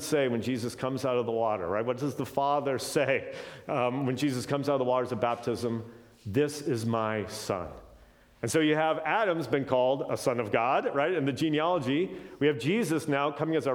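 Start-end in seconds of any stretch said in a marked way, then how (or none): 6.2-7.7 drawn out, each word slow; slow solemn quote. Acted. Teacher quoting the Father at the baptism, slow and solemn. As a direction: let the Father's words land slowly.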